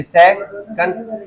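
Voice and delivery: Second slowly split into से and कंड; syllable by syllable. Shown as a demonstration. Teacher showing what a syllable is, slow split second. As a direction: draw second out in two high, separate beats. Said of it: "ये जो है वो syllable है".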